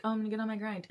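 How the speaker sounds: mock hype to yourself; pumped-up resolve. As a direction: announce it like you're finally getting going. Quoting her own past resolve.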